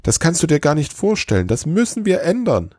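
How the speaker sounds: high-pitched, urgent elf; still excited. A narrator's reading. Elf urges Santa to change things, excited. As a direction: insist on it, all worked up. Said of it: "immer noch aufgeregt".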